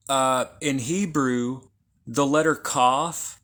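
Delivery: ordinary talk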